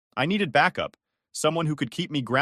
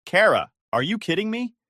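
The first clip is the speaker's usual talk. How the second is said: raised, higher and louder in disbelief; voice rising; 8 semitones higher, 5 dB louder than usual. Acted. Brother confronting sister, voice rising in disbelief. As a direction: push the pitch and volume up, incredulous. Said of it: "my voice rising".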